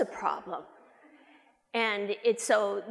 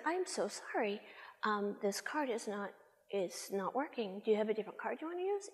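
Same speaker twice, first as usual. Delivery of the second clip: apologetic hush; 8 dB quieter than usual. Retold or imitated. Apologizing over declined card.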